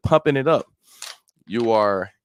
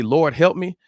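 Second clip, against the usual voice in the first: mild acted yell; 7 semitones higher than usual. Preacher acting a believer yelling out to God, mild yell. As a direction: call out in a mock cry for help. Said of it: "when I'm yelling out to you".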